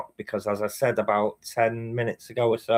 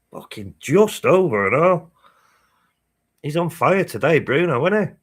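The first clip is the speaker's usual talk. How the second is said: raised, loud excited cheer; thrilled by a shot from halfway; 6 semitones higher, 6 dB louder than usual. Real, not acted. Streamer reacting to Bruno's shot, loud and excited.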